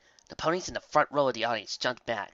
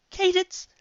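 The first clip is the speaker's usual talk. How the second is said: very high panicked cry for help; terrified plea; frightened scream; 15 dB louder, slower than usual. A narrator's reading. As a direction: shriek for rescue. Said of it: "she screamed in their thoughts".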